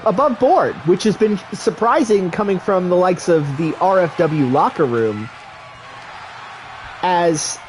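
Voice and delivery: excited wrestling announcer; play-by-play commentary. Acted. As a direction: call the match like a ringside commentator.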